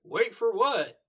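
high, loud puzzled retort; Granny Smith. Retold or imitated. Granny Smith having forgotten the jam, loud puzzled voice. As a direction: blurt it up high as a forgetful granny. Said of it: "Beat Granny Smith".

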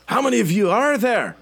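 high, urgent put-on female voice; startled woman soldier. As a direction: play a woman officer calling out to a stranger. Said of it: "she spins around and looks at you, and she goes".

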